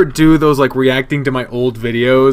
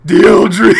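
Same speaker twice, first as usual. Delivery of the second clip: loud, raised put-on voice; Darius pirate mix; 4 semitones higher, 8 dB louder than usual. Shown as a demonstration. Streamer starts reading comment in requested voice, loud put-on voice. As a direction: launch loudly into the silly voice. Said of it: "you gotta mix in the".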